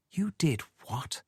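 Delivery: quietly. A narrator's reading. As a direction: barely breathe it out in horror. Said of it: "she said quietly".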